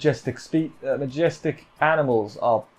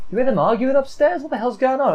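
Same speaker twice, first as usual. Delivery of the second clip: high, annoyed mock voice of the guys downstairs; grumpy lads; 10 semitones higher than usual. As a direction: squeak it out like irritated gamers. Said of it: "They like".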